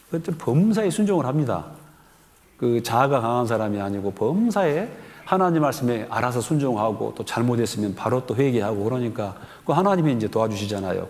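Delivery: ordinary talk, nothing marked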